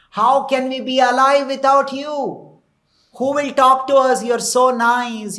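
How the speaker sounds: high, loud pleading, acting out grief; imitated lament. Widowed queens speaking to their dead king, acted lament. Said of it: "Like this, they are crying".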